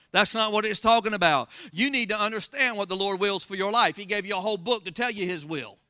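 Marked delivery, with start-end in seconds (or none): none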